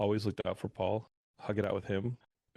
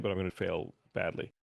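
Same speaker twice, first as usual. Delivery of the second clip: ordinary talk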